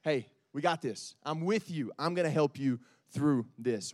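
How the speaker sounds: preacher modeling calm words to say to fear; calm reassurance. Shown as a demonstration. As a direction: talk your fear down gently. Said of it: "what we're gonna say to that fear".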